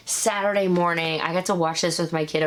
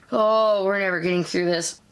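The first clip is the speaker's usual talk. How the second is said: normal talking voice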